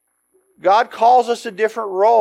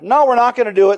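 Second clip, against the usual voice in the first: mad refusal; 4 semitones higher, 6 dB louder, faster than usual. Acted. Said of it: "Then they got mad".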